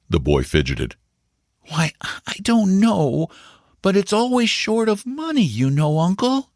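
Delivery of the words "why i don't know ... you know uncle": put-on a child groping for words; hesitant boy. A narrator's reading. Boy struggles to tell his uncle about the house, hesitant and high. Paul voiced in the read story.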